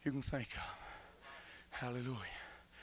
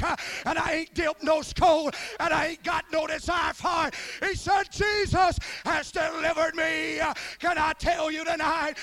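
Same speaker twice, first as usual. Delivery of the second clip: loud, high revival preaching; preacher shouting the deliverance story; fiery preaching; 5 semitones higher, 8 dB louder than usual. Acted. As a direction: preach it hard. Preaching at full force.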